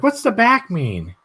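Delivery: put-on high, slow child voice; little kid. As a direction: ask it like a curious seven-year-old. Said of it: "the kid's like".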